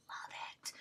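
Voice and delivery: whispered confession. Real, not acted. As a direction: breathe it in a happy whisper. Confiding aside.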